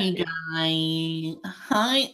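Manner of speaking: high, drawn-out put-on voice; imitated YouTuber greeting; mock kid greeting. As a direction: stretch out a silly high hello. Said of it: "I'm not gonna do the I F U thing again".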